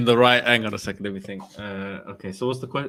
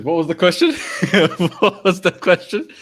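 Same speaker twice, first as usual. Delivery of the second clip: laughing through the words; 3 semitones higher than usual. Real, not acted. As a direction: crack up while losing your place. Cracks up, loses his place mid-impression.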